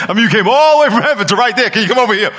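laughing through the line, quick and bright. Real, not acted. Preacher laughing as he jokes with God. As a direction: laugh while teasing God.

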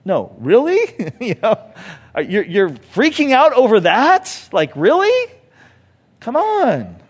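mock incredulous, rising high; playful Jesus teasing back, with a laugh; teasing disbelief. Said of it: "And he's like".

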